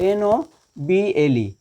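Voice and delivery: slow, measured letter-by-letter spelling. Shown as a demonstration. Teacher spells noble for the class, slowly letter by letter.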